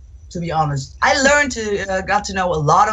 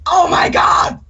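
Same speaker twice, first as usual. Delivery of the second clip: loud, high, breathy yelled gasp; a fan shouting in shock; grocery store stranger yelling in surprise; mock yell; 10 semitones higher, 5 dB louder, slower than usual. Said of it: "Why are you yelling at me?".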